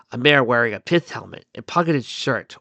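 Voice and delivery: ordinary delivery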